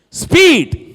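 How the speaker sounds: high, loud one-word call; shouted announcement. Real, not acted. Preacher announcing the fourth S, shouted announcement. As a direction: fire out the heading to the crowd.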